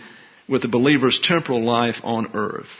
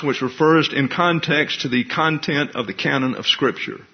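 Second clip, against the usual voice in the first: ordinary talk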